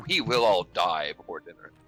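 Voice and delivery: ordinary delivery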